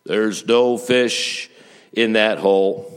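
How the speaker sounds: loud, very slow mysterious announcement; drawn-out, booming pronouncement; voice from above. Acted. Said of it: "he hears a voice".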